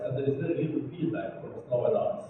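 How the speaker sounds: ordinary delivery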